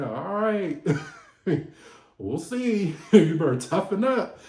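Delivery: laughing, playful warning; laughing tease. Retold or imitated. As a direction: tease him while laughing. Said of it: "And she said".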